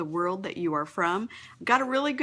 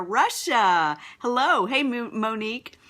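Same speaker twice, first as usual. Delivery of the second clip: ordinary delivery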